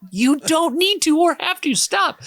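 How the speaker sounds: high, squeaky exasperated mock plea; high cartoonish mock of the waitress. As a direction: squeal it like a fed-up caricature. Mocking the waitress's refusal.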